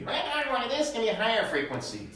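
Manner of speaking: jumps up to a high voice to show high frequency. Shown as a demonstration. Pastor showing the class high frequency, high voice. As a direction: pitch it up high for the demo.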